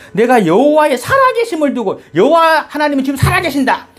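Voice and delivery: high, ringing sworn declaration; solemn oath. Acted. Saul swears to the medium by the living God, solemn high oath. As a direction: proclaim it as a binding vow. Saul's oath acted out for the congregation.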